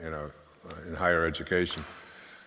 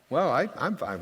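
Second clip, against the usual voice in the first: put-on higher, small-child voice, a bit offhand; child's answer; 9 semitones higher than usual. Small daughter answering dad about herself, higher childlike. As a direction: answer like a little kid who's fine.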